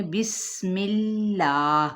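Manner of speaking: بسم اللہ slow, with a clear whistling sin; whistled sin. Shown as a demonstration. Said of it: "سیٹی کی آواز نکالنی".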